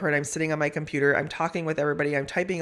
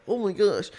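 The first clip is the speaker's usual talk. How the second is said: awed; 5 semitones higher than usual. Retold or imitated. Awe at hearing a man pray.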